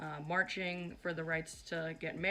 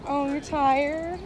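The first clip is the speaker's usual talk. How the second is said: teasing; 7 semitones higher, 12 dB louder, much slower than usual. Real, not acted. Teasing friend on the street.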